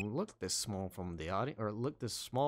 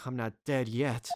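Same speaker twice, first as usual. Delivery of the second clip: ordinary talk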